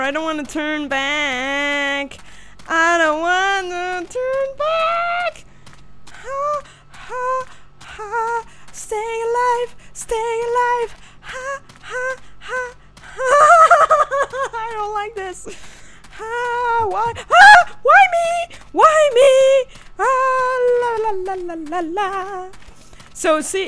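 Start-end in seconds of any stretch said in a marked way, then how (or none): 0.0-5.4 slow whine climbing to a high squeal; scared whine. Real, not acted. Scared player dreading the way back. 6.1-22.5 high gasping whimper with sudden shrieks; panicked whimpering. Real, not acted. Terrified player panting and pleading.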